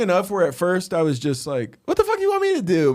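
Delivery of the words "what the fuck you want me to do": fast, very high mock protest; squeaky-high retold disbelief; amused protest. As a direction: protest as if it's all a joke. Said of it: "I'm, like, laughing".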